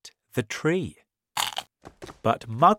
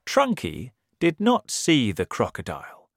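plain reading voice

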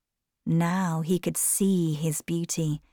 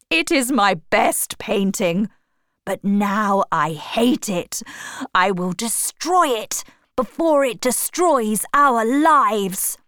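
louder, rising fury; angry shout; 4 semitones higher, 4 dB louder than usual. A narrator's reading. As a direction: build toward the threat to destroy it. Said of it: "shouted Basil".

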